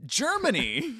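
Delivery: high, loud, drawn-out cheer; excited exclamation. Real, not acted. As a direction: call it out high and gleeful. Excited answer about favourite country.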